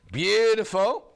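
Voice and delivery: gushing. Real, not acted. Admiring fabric on air.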